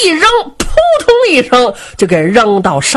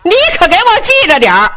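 high-pitched, hurried call to a pal below; shouting down; 10 semitones higher, 4 dB louder, much faster than usual. Acted. Jin Jia to his brother below the stage, shouting. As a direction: holler it down from the stage, high and quick. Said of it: "冲着铜环的嚷".

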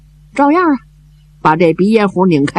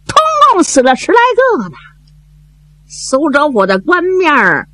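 Zhao Bi's urging voice; 10 semitones higher than usual. Acted. Zhao Bi urging widow and daughter.

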